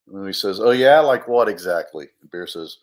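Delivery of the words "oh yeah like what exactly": low, loud retort; challenging. Acted. Hero challenging the bear's warning, skeptical retort. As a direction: throw it back as a dare.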